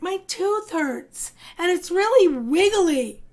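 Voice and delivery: put-on high, slow child's complaint; young elephant tells mom her tooth hurts, high-pitched; worried little elephant. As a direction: whine a little about the wobbly tooth. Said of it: "Elda cried".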